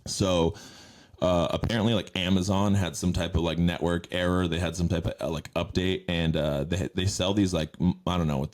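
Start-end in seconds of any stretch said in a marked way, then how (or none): none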